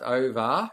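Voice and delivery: OVER drawn out, rising at the end; upturn on OVER. Shown as a demonstration. Host signing off to test the Melbourne ending, OVER turned upward. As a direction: stretch OVER and lift its last syllable. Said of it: "Is that what you mean?".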